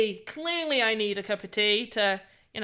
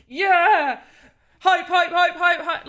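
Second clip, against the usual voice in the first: higher, louder hammed-up hype chant; mock hype; 5 semitones higher, 4 dB louder than usual. Shown as a demonstration. Streamer shows what her hype would sound like, hammed-up excitement. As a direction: pump up the crowd like a parody hype streamer. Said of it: "I'll just be like".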